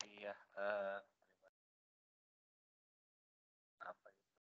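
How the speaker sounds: faint voice far from the mic; almost inaudible, distant speaker; barely audible. Real, not acted. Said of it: "suaranya terlalu kecil banget".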